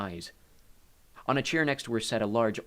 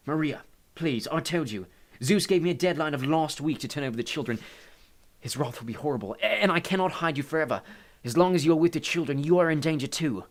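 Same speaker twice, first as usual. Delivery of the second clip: higher, urgent, raised plea; pleading Hades; 6 semitones higher, 6 dB louder than usual. A narrator's reading. Hades begs Maria to see the danger, pleading. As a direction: warn her of danger, pleading.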